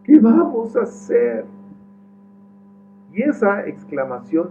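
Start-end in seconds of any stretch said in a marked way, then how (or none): 0.0-1.4 much higher, louder panicked plea; panicked question. Acted. Servant to the prophet, panicked plea. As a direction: ask in a panic what to do. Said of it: "exclamó el criado".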